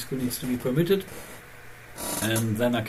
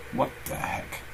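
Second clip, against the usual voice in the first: whispered what the heck; 7 dB quieter than usual. Real, not acted. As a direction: breathe it out under your breath. Muttered aside at odd listing.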